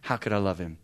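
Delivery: soft, hushed retold prayer; quiet prayer. Son quietly prays for patience with his dad. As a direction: pray it under the breath. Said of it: "I started praying".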